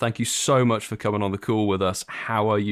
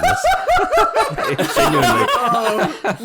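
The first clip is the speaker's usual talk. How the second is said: much higher, louder laughing through the words; nervous laughter; 6 dB louder, much slower than usual. Real, not acted. Co-host nervous before a big introduction, laughing through it. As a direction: laugh off the nerves, stretching genuinely slowly. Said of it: "God, my heart's pounding".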